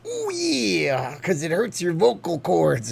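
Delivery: Macho Man voice. Acted. Streamer doing the requested Macho Man, high raspy wrestler. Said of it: "Do a Macho Man".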